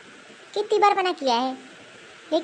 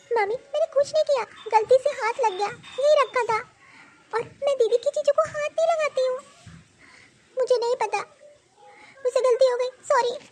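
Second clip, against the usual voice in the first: put-on child apologising; 5 semitones higher than usual.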